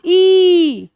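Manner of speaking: "ई" held long like a phonics demo; long sound. Shown as a demonstration. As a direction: stretch "ई" out in one breath. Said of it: "लंबा sound?". ("ई" को लंबा खींचकर बोलें)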